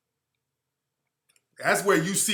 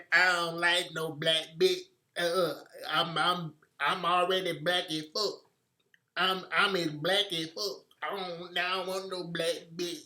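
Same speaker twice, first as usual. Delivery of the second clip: mocking imitation; 5 dB quieter than usual. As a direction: parody his interview answer, slowed and quieter. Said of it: "sounded like something from Central Casting of Samboing".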